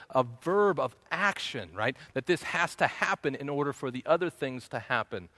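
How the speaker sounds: ordinary talk, nothing marked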